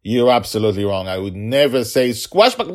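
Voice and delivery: loud, high, quick protest; father hamming up his denial; emphatic playful insistence; mock indignation. A narrator's reading. Father playfully denies again.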